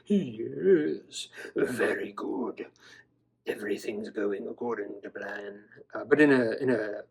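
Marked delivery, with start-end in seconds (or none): 0.0-2.7 1 scheming villain. Pretend. Toy dino voiced plotting villainously. 3.5-5.6 1 scheming villain. Pretend. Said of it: "He's like".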